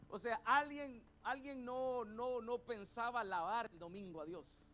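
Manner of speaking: preaching at full force. Acted. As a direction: build the sermon up to a shouted climax.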